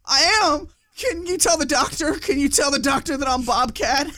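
high, loud pleading comic voice; high, desperate, relieved plea. Retold or imitated. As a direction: beg them to tell the doctor. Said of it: "And he goes".

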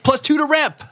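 high game-show reward call; announcer cheer. Acted. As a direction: sing it out like a quiz host.

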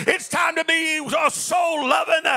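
rising to a full preaching shout. Acted.

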